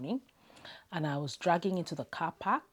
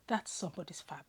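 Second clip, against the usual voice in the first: sighing whisper; 6 dB quieter, faster than usual. Real, not acted.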